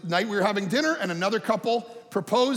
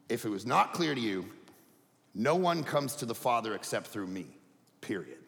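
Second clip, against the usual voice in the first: solemn quiet quote; 9 semitones lower, 7 dB quieter than usual. Acted. Quoting Jesus, low and soft.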